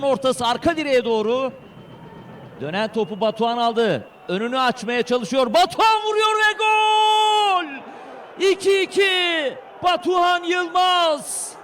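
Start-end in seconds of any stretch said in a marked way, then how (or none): 5.5-11.5 screaming football commentator. Real, not acted. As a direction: draw out the scorer's name at full voice. Equalizing goal in live match.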